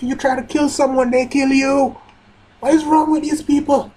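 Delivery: high-pitched outraged yelling; angry rant. Real, not acted. Reader berating a manga character, outraged high-pitched rant.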